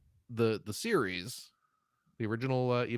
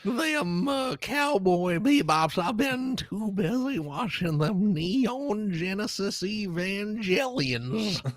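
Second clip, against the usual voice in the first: put-on high slow old prospector drawl; 6 semitones higher, much slower than usual. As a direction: play a grizzled old prospector. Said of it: "old anime prospector".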